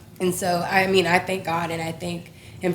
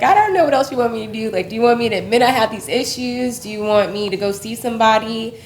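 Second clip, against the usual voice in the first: higher, louder plea as if praying; retold exasperated prayer; 4 semitones higher, 6 dB louder than usual. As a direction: replay a frustrated appeal to God. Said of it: "I had prayed for a while".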